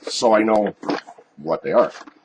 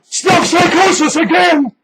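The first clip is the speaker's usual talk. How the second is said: excited catchphrase; 13 semitones higher, 3 dB louder than usual. Acted. Show catchphrase shouted high.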